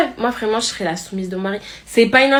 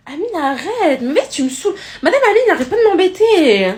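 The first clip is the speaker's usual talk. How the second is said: high whine, building louder; whiny pupil protest; 7 semitones higher, 3 dB louder than usual. Acted. Schoolgirl telling the teacher that Amine keeps bothering her, whiny protest. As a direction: protest loudly and tell on him.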